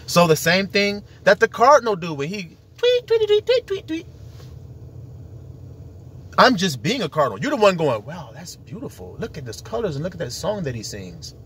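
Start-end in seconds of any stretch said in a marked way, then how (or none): 3.1-4.0 high chirped tweets; bird tweets. Retold or imitated. Imitating a cardinal's song, high tweets. 8.0-11.3 soft, low awestruck murmur; hushed admiration. Retold or imitated. An onlooker admiring the cardinal, softly.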